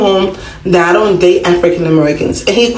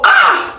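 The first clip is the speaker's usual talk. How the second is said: short, very high yelp of exasperation; a quick high-pitched cry, then drop it; sudden shriek. Real, not acted. Frustrated outburst over medical words.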